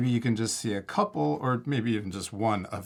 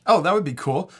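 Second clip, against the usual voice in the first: bright, louder, higher delight; excited; 6 semitones higher, 7 dB louder than usual. Real, not acted. Child suggests a paintbrush.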